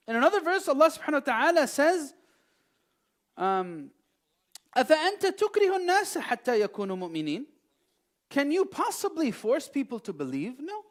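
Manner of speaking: high and loud proclamation; impassioned preaching. Real, not acted. Preacher declares the verse to listeners, high and loud. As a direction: proclaim the verse with full force.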